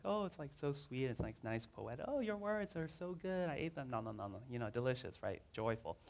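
mock sweet. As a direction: parody a saccharine reading.

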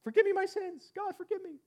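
high, strained cry for forgiveness; woman blurting a prayer as the tornado hits, panicked; blurted panicked plea. Retold or imitated. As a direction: blurt it out in sudden terror. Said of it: "you just blurt out".

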